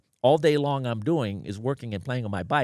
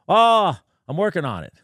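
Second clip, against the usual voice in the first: defensive electrician; 6 semitones higher, 7 dB louder than usual. Retold or imitated. Voicing unlicensed electrician, retold.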